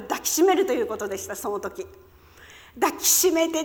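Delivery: ordinary talk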